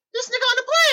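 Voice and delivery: very high squealed disbelief; mock astonished outburst. Moviegoer spotting the actor on the plane, mock astonished squeal. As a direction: squeal it like a hammed-up stunned passenger. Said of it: "looked back and be like".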